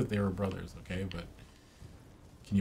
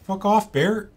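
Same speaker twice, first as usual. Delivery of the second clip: yelling at a bear; 10 semitones higher, 11 dB louder, slower than usual. Retold or imitated.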